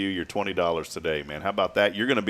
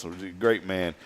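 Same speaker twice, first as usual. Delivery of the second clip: ordinary talk, nothing marked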